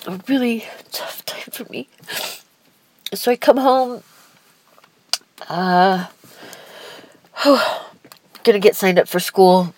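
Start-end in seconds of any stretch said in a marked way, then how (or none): 0.1-1.9 a real sob breaking into the word, loud and high; choked-up cry. Real, not acted. Tearing up while recalling hard time. 7.4-7.8 a long, high, audible sigh; heavy sigh. Real, not acted. Sigh while recalling coming home.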